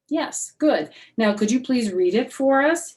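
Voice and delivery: a teacher politely insisting. Acted.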